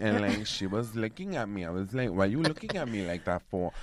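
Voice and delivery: sassy New York Puerto Rican woman, dragging the words; Suzanne. Acted. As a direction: put on a big Nuyorican street accent. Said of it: "I'm gonna give you her, like, Suzanne".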